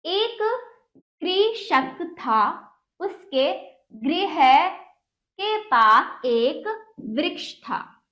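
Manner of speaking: very slow, emphatic word-by-word reading; deliberate dictation-like story reading; read-aloud lesson. Acted. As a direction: read it out slowly for young pupils, pausing between words.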